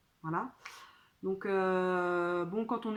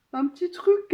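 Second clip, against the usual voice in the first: high, loud excited squeal; 10 semitones higher, 11 dB louder than usual. Real, not acted. Showing viewers a little purchase, excited squeal. Playful reveal of a new purchase.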